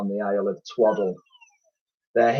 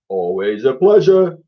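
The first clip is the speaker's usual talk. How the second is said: louder odd blobby creature voice; slime creature voice; 3 semitones higher, 6 dB louder than usual. A narrator's reading. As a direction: speak as a friendly slime.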